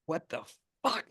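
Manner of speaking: stunned gasp of a question; shocked. Acted. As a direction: caught off guard by sudden pain. Needle plunged into his neck.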